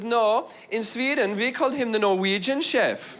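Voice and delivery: put-on high, slow Swedish accent; imitated Swedish English; Swedish woman. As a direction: answer in a lilting Swedish accent. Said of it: "talking one night to a Swedish woman".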